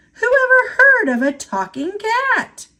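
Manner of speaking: alarmed squeak of realisation; startled. Acted. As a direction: it dawns on him all at once, mid-thought. Sean's sudden realisation.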